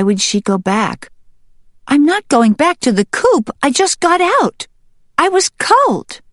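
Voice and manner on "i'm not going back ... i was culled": very high hen voice, indignant; high-pitched, insistent hen; protesting hen. Acted. Hen protests returning to coop.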